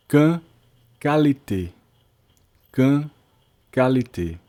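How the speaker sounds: Quand said kan and qualité said ka-li-te, hard k sound; French qu as k. Shown as a demonstration. Teacher showing students qu sounds like k, French pronunciation. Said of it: "Sempre o som de C".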